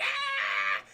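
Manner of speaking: drawn-out screech like a velociraptor; mock creature shriek. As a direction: imitate a raptor screaming on the run. Imitating a running velociraptor creature.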